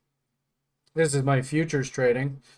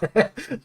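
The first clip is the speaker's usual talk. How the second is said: laugh; 7 semitones higher than usual. Real, not acted. Laughing at the story.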